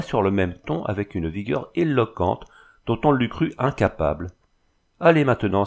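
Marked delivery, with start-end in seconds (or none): 5.0-5.6 louder bitter outburst; he flings the words out, louder; bitter cry. A narrator's reading. Abandoned husband lashing out at his wife: a louder bitter cry. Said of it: "s'écria-t-il".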